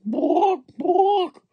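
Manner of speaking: very high hen clucks; clucking hen. A narrator's reading. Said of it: "Then she said".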